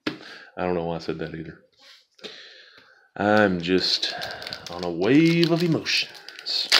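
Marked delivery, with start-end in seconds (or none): 4.7-6.7 mock dramatic. Dramatic self-mocking line.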